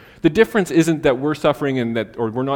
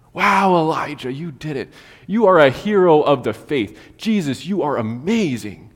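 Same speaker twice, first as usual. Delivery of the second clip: acted awe with drawn-out emphasis; put-on wonder at the heroes; mock admiration; 3 semitones higher, 4 dB louder than usual. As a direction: gush over a hero, stretching the big words. Mock praise of Bible heroes.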